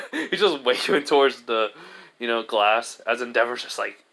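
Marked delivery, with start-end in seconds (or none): none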